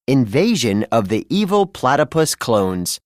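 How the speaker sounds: ordinary delivery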